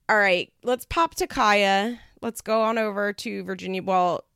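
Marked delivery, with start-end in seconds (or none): none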